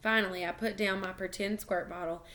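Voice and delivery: ordinary delivery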